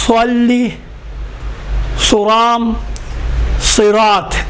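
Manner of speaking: thick rounded "sw" in سولی، سرام، صراط; emphatic s, spaced word by word; heavy saad. Shown as a demonstration. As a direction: keep saad full even in صراط. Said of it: "اما صوات میں ایسا نہیں ہے".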